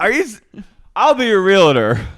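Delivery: high, loud eager offer; drunk Miranda. Retold or imitated. Miranda offering to sell Carrie's place, loud eager voice. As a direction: jump at the chance, tipsy and eager. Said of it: "she goes".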